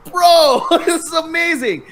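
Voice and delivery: high, excited retold outburst with a laugh. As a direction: gush at a stranger, pitch soaring. Retelling excited run-up to stranger.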